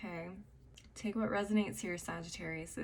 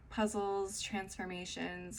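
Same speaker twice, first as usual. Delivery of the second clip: usual speaking voice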